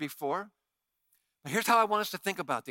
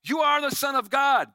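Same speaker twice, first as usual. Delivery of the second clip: loud, higher demon shout; 6 semitones higher, 7 dB louder than usual. Acted. Demons shout at Jesus, loud and high. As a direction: cry it out as a demon.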